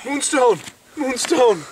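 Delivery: high, thrilled cry of a name; overjoyed eureka call; excited discovery. Acted. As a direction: shout it like you just found treasure.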